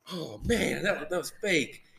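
deflated on waking from the dream; disappointed. Retold or imitated. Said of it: "And then it was like".

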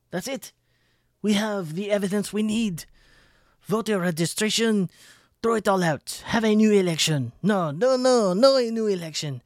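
high, loud mock panic; sarcastic frantic demanding; mock hysteria.

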